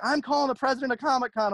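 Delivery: high-pitched imitation of an irate customer; mock indignant threat. Retold furious customer's threat.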